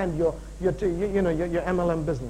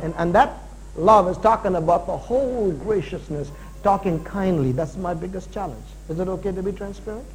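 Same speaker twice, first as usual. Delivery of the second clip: ordinary talk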